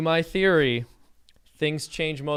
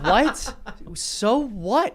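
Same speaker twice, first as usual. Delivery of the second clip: laughing disbelief; 7 semitones higher, 5 dB louder, much slower than usual. Real, not acted. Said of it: "reacting to the lawyer's question".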